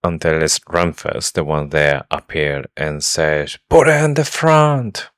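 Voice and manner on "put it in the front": high, bad Scottish accent; badly faked Scottish accent. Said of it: "he says with his Scottish accent".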